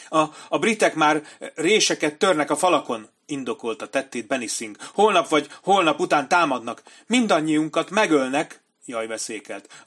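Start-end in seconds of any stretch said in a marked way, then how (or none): 0.1-3.1 high, loud, panicky coward's voice; frightened commander. A narrator's reading. Benny Singh's voiced lines. 4.9-8.6 high, loud, panicky coward's voice; frightened commander. A narrator's reading. Benny Singh's voiced lines.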